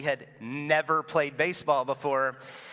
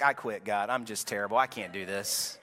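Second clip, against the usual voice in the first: lower, deflated voice of giving up; a touch of despair; defeated; 7 semitones lower, 3 dB quieter than usual. Retold or imitated. Believer giving up on God.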